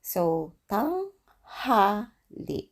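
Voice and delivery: tanghali drawn out very slowly. Shown as a demonstration. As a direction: stretch tanghali. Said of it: "slow pronunciation demo".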